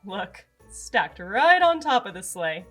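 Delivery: usual speaking voice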